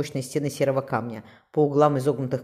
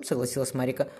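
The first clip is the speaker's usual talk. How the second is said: plain reading voice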